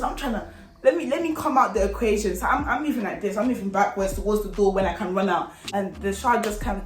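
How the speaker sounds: usual speaking voice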